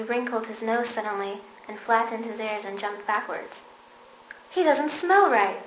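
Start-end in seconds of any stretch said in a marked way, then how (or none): 4.5-5.6 loud, high, slow exclamation; exclaiming. A narrator's reading. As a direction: exclaim in alarm, drawn out. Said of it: "he exclaimed".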